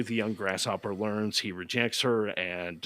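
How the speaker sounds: normal talking voice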